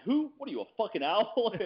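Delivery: high mock outburst; Pacino line quoted. Answering Dale at the UFC party with the Heat line, high mock outrage. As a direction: blurt it like the movie scene. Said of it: "I'm like".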